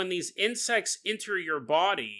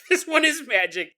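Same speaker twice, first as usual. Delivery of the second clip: louder, insistent repeat; emphatic shout; 7 semitones higher, 6 dB louder than usual. Real, not acted. Insisting to Jason, shouting it's magic. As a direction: shout it to win the argument.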